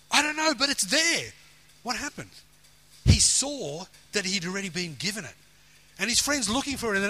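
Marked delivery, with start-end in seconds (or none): 0.1-1.3 higher-pitched, emphatic retold quote; acting out an urgent, certain reply; excited insistence. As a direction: insist on it as a sudden realisation. Retelling a sudden realisation to friend.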